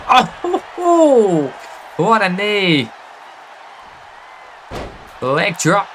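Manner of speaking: hyped play-by-play calls; wrestling commentator. Acted. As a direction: call the big moves like ringside.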